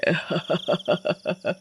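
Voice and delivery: soft, rapid gloating cackle; witch cackle. Acted. Witch laughs before drinking from the bottle, soft cackle. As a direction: chuckle wickedly to yourself, softly.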